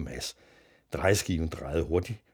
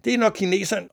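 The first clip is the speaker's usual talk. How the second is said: ordinary delivery, nothing marked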